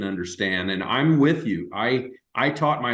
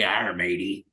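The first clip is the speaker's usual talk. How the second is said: pirate voice; 3 semitones lower, 3 dB quieter than usual. Pretend. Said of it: "International Talk Like a Pirate Day".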